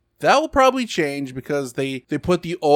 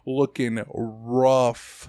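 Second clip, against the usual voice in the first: very slow, stretched words; drawn-out verdict; much slower than usual. Real, not acted. Host judges the remake's graphics, drawn-out verdict. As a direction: drag out "rough" as a long judgment. Dwelling on the remake's bad look.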